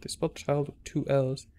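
ordinary delivery

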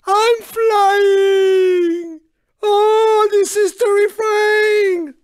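high, drawn-out cry of panic; hammed-up airborne terror; terrified wail. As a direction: wail it as if hurtling through the air. Car flung into the air.

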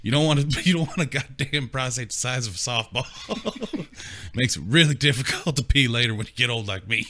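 put-on high, loud, laughing old-man warning. Friend's old dad warning him about his prostate, loud and high. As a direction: hammer home an old man's advice, half laughing. Old man's advice voiced.

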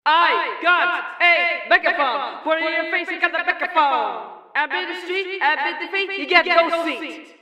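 over an intercom; tinny phone-line voice; telephone effect. Shown as a demonstration. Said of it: "you can go to telephone. Select that and it'll sound like this".

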